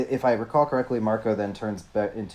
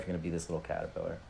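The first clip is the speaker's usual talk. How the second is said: teasing; 7 semitones lower, 13 dB quieter, much faster than usual. Acted. Voicing alien bargain.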